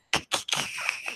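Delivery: sharp voiceless gasp; shocked gasp. Real, not acted. Reacting to a gross story with a shocked gasp. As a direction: suck in air in disgust.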